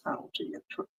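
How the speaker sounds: full whisper, barely audible; a secretive teasing whisper. Real, not acted. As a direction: whisper so a viewer at work stays out of trouble. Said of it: "I won't be too loud".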